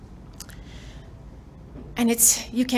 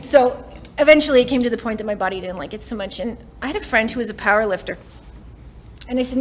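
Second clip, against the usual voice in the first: usual speaking voice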